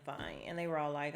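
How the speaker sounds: ordinary delivery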